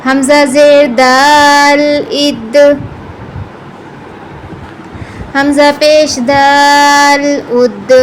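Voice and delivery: echoing d at the end; with qalqala. Shown as a demonstration. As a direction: release the د in اد with a bounce, slowly. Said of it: "قلقلہ".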